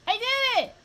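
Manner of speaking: very high, loud squeal of victory; real burst of excitement; triumphant shout. Real, not acted. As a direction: shriek it in delight. Celebrates a success in game.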